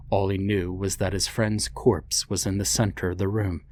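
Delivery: plain reading voice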